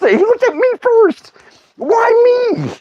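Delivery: put-on very high squealed kid protest; panicked whine. Youngest kid when grandpa looks at him first, panicked squeal. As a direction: squeal it like a singled-out child.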